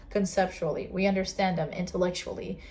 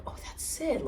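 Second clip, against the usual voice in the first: whispered higher, softer, a sudden inner discovery; breathless whisper of realization; 8 semitones higher, 7 dB quieter than usual. Retold or imitated. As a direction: breathe it out as a quiet aha moment. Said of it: "Suddenly you're like".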